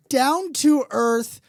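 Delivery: ordinary talk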